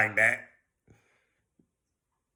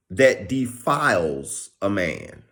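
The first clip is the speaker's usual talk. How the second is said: ordinary delivery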